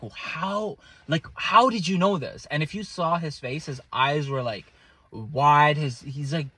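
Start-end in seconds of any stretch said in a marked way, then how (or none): 0.0-2.5 amazed disbelief. Retold or imitated.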